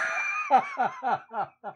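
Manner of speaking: very high squealing laugh; cracking up out of the bit; shrieking laugh. Real, not acted. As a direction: burst into a shrill laugh. Laughter breaking the ghost voice.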